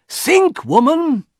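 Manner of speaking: high, forceful appeal; exasperated cry. A narrator's reading. Husband urges wife to think, crying out. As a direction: cry out to make her see sense.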